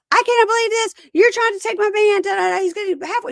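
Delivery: put-on high-pitched, loud angry girlfriend; retold shrieky tirade, easing off in volume; mock yelling. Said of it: "She's yelling at him".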